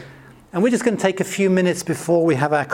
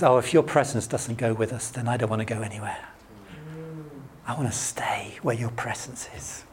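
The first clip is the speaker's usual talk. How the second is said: softer and lower, fading to a whisper; hushed relief; 5 semitones lower, 7 dB quieter than usual. Retold or imitated. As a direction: breathe it out as if clinging close. Moses voiced, relieved, clinging.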